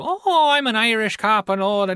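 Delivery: high, hammed-up Irish cop accent; quick jokey stage Irish accent. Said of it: "if Peter Sellers were to do".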